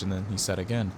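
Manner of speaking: ordinary delivery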